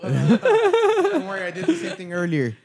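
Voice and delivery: laughing through the words, pitch jumping up. Real, not acted. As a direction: giggle it out while reassuring. Laughing at mic fumbling.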